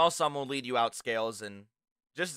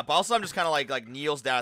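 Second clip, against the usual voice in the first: ordinary delivery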